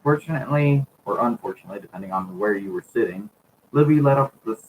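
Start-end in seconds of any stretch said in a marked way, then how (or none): none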